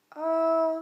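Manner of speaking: "A" held high, loud and level. Shown as a demonstration. Teacher models the first tone, "A" high and level. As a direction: sustain "A" on one high pitch, like singing. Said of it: "le premier ton est haut et plat".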